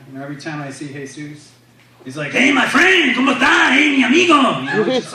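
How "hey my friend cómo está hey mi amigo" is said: upbeat, energetic friend. Retold or imitated. Upbeat friend greeting the comedian, exuberant and full of life. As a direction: greet a pal with bursting high-pitched energy. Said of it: "He's a really upbeat, energetic guy".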